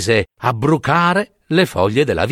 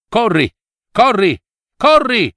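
shouting; 8 semitones higher than usual. Acted. Stag shouting to his fawn to flee, rising alarmed yell. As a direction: yell at your son to run for his life. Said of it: "il cervo gridò al figlio".

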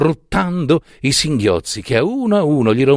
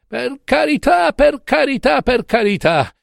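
very high, wailing supplication; tearful moaning plea; 14 semitones higher than usual. A narrator's reading. Drunken professor begging his friend for mercy, wailing. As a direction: beg in a high, hammed-up tearful wail. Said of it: "gemeva, implorava il professor Carmelo Sabato, tra le lagrime".

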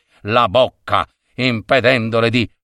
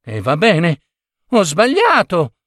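higher-pitched, mildly defensive admission; conceding confession; 6 semitones higher than usual. A narrator's reading. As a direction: give in to a scolding wife. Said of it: "confessò Biagio". (ammissione rassegnata, un po' acuta)

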